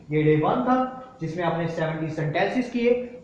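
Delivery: ordinary delivery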